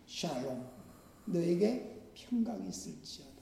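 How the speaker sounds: very soft, hushed words of peace; hushed tender blessing. Acted. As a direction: breathe the blessing out gently.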